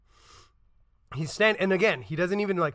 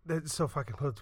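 usual speaking voice

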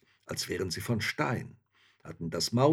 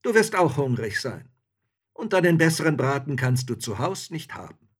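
loud, raised coaxing; hearty soldier; 6 semitones higher, 9 dB louder than usual. A narrator's reading. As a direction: soldier urging a friend to eat. Soldier keeps calling to hunter.

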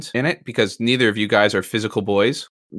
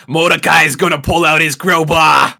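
loud imitated character voice; 6 semitones higher, 6 dB louder than usual. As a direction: mimic his character voice. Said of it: "third personing yourself, like, in your own character voice".

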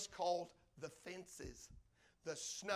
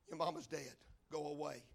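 cold dismissal; 5 semitones lower, 5 dB quieter than usual. Acted. Guards dismiss the begging children.

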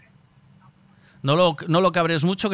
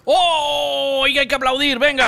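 excited; 6 semitones higher, slower than usual. Real, not acted. Celebrating passing the seatbelt check.

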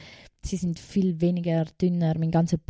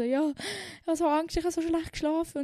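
high, whiny voice; whiny retold plea; 9 semitones higher than usual. Telling the gym teacher she's scared, high whine. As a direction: whimper it like a frightened child.

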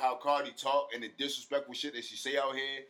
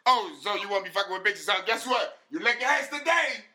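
higher, louder mock [name] taunt, rising to a peak; [name] impression; 6 semitones higher, 5 dB louder than usual. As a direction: taunt him in a put-on [name] voice. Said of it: "I hate your [name] accent real bad".

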